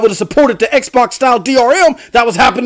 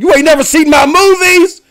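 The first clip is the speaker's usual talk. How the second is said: Samuel Jackson; 3 semitones higher than usual. Retold or imitated. Host explaining his talk to a viewer, celebrity impression. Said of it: "Samuel Jackson impression".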